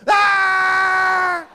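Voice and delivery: long, high, loud scream; one sustained full-voiced "Ah"; groom's scream. Groom seeing the bride's face, long loud scream. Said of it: "so you can't hear the groom go".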